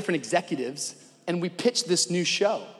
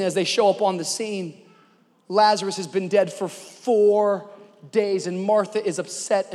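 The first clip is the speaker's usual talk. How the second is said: ordinary talk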